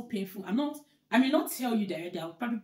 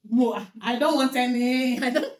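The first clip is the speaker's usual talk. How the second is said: laughing refusal; 5 semitones higher, 10 dB louder than usual. Real, not acted. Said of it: "refusing to look at her".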